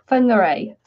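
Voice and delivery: "Whangarei" opening with an f, ending in ay; Maori pronunciation. Shown as a demonstration. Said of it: "That's how the Maoris pronounced it".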